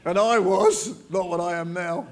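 laughing through a self-mocking joke. Real, not acted. Guest speaker joking with the pastor about his past, laughing. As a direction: chuckle at your younger self as you say it.

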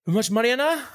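very high-pitched West Wales cameraman; West Wales accent. Retold or imitated. Cameraman asking about podcast money, high West Wales accent. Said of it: "very West Wales accent".

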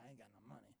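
very low, barely voiced aside; retold quiet admission. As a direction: say it under your breath, imitating an awkward admission. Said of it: "I'm like".

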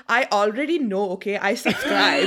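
loud imitation of an Indian-accented mother; exasperated mom with an Indian accent; mom's Indian accent. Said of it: "you can't do that voice".

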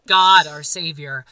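ordinary talk

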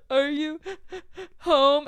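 imitated tearful gasping; upset. Retelling a call to a friend after a bad date, mock crying. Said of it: "I was really upset".

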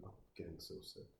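whispered, sickened aside; muttered to himself, feeling sick at the screen; nauseous whisper. Real, not acted. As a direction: breathe it out, queasy. Queasy aside while watching.

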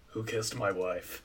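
slow, low and menacing; low menacing gunslinger. Acted. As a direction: growl it like a threatening outlaw. Voicing Liam Neeson's threat.

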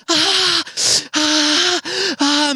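high repeated "ah" cries; hammed-up squealing; excited squeals. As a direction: imitate a kid squealing with the ball. Excited kid holding the ball.

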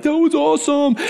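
put-on high thrilled kid voice; excited kids. Kids at the bottom of the zip line, high thrilled squeal. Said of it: "they were like".

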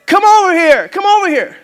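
loud, high call from far off; big drawn-in hey, insistent calling; screaming parrot. Acted. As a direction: holler a buddy over as a pushy parrot. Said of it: "this parrot is screaming in the background".

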